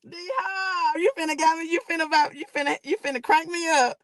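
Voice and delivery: high, laughing, hyped-up shout; laughing excitedly. Real, not acted. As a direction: cackle it out, totally hyped. Laughing at friend's hype.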